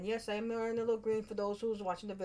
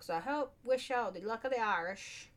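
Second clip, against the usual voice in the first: higher-pitched put-on Irish accent; attempted Irish accent; 4 semitones higher than usual. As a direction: send good wishes in a shaky attempt at brogue. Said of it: "What kind of accent am I trying to do there?".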